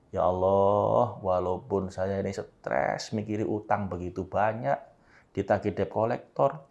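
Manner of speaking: slow, soft, humble plea to God; heartfelt prayer. Shown as a demonstration. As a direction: pray it from the heart, quietly. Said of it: "mengucapkannya bener-bener dengan sepenuh hati". (doa lirih dengan sepenuh hati)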